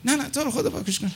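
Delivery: high, soft imitation of a begging nephew; mock nephew whining "please delete it"; whiny pleading. Nephew begs to delete recording.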